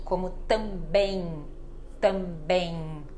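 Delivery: também ending nasal like N, mouth not closed; final M as N. Shown as a demonstration. Teacher showing students the final M in também sounds like N. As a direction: end também on an N sound, lips open. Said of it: "Ela fica com som de N".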